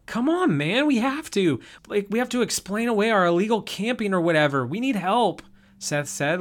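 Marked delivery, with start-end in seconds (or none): 0.1-0.6 high, pleading burst; losing his nerve. Acted. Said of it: "losing his nerve a little bit". 0.6-5.4 higher, anxious pleading; a nervous guy begging the group; losing his nerve. Acted. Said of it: "losing his nerve a little bit".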